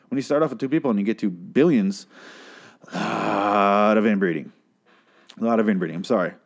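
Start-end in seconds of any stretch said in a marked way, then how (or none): 2.9-4.5 "a lot" stretched long and slow; drawn-out emphasis. Real, not acted. Host on two people becoming billions, stretched-out "lot". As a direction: draw out the start of "a lot of inbreeding". First take, drawn out for effect.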